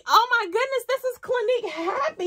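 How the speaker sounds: it finally clicks, thrilled; thrilled realization. Real, not acted. Realization of the scent.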